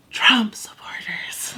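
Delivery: slow mock-secretive whisper. Classmate confessing her parents' politics in class, imitated whisper. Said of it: "Like, she whispers it".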